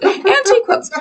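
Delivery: high, squeaky laugh through the words. Real, not acted. Host echoes her co-host's funny phrase, laughing. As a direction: echo it helpless with giggles.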